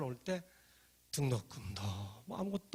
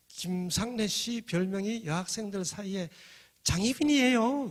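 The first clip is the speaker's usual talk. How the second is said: slow teasing reveal, louder and higher at the end; giggling tease; 5 semitones higher, 5 dB louder, slower than usual. Retold or imitated. Student teasing a poor classmate, mock giggling. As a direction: draw it out like a giggling girl. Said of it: "깔깔깔깔 그렇게 웃어요".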